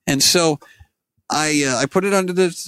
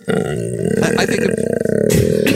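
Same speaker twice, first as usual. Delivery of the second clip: muffled, like speaking into a cup; hollow cup-muffled voice; talking into a cup; much slower than usual. Real, not acted. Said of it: "talking into a cup".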